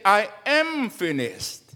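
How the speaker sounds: "I am finished" slowed, "am" leaned on; slow stress on am. Shown as a demonstration. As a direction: draw out "am" as the wrong version. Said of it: "Jesus didn't say".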